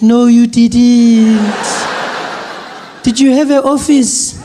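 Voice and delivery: put-on a delighted old granny drawing it out; grandmother voice. Grandmother on the phone with Trevor, delighted, high and slow. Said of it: "She's like".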